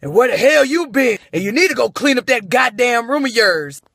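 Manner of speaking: mock grumpy dad barking at his son; lightly voiced grouchy father; big scruffy voice. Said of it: "I hear this big scruffy voice".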